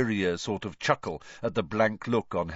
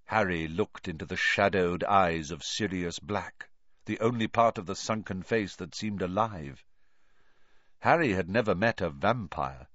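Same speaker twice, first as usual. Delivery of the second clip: usual speaking voice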